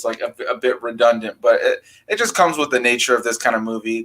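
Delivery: ordinary talk, nothing marked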